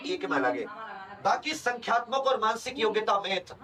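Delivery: usual speaking voice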